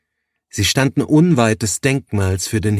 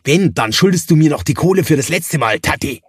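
fast, higher, louder comeback; heated retort; 6 semitones higher, 3 dB louder, faster than usual. Acted. Johannes firing back at Thaddäus about the money, heated. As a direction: throw the debt right back at him.

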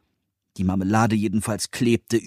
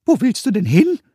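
hohe, laute Koboldstimme, neugierig; fragender Kobold; 12 semitones higher, 7 dB louder than usual. A narrator's reading. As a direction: Kobold fragt neugierig nach. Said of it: "fragte Pumuckl". (Pumuckl fragt Meister Eder, hoch und laut)

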